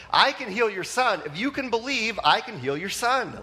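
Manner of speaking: high, forceful insistence; bold retort. Retold or imitated. Jesus answering the father, high and bold.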